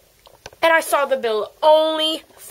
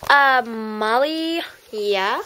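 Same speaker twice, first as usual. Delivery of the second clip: ordinary talk, nothing marked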